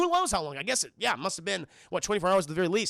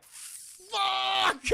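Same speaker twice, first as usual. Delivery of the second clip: frustrated shout; 12 semitones higher than usual. Retold or imitated. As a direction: curse it to the sky. Tuco's frustration.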